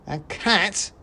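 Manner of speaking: high, loud squeak; shouting mouse. Acted. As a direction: yell it in tiny outrage. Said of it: "shouted the first mouse".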